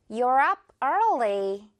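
very slow, early stretched; slow repeat. Shown as a demonstration. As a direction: model it slowly again. (천천히 다시 말하기)

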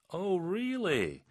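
high, stretched-out skeptical question; sarcastically impressed; mock disbelief. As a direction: draw it out high, pretending to marvel. Acted doubt at a boast.

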